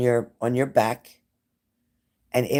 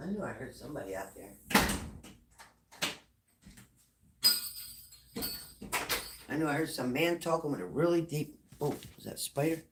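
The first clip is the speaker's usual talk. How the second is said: hushed, fast and much softer, listening for noises outside; 9 dB quieter, faster than usual. Real, not acted. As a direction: keep it down as if someone might hear. Hears someone outside at night.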